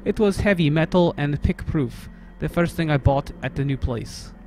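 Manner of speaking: put-on lightly held Russian accent reading the game text. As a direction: narrate the story in mock Russian.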